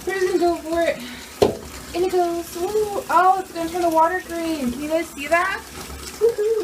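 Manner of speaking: high, bubbly squeals of delight; thrilled as the bath bomb fizzes; excited. Real, not acted. Vlogger squeals to viewers at the green water. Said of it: "I'm so excited".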